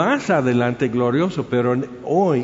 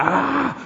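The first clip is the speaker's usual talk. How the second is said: high, drawn-out "aah" of a roaring mob; imitated crowd roar; 7 semitones higher than usual. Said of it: "rugiendo la multitud". (rugido imitado de multitud)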